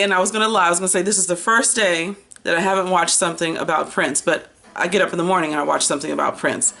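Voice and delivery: ordinary delivery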